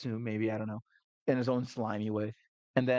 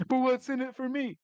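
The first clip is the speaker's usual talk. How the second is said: much higher, scheming voice; high mocking woman; 13 semitones higher, 3 dB louder than usual. Rogue woman demanding payment to guide them, high sly voice.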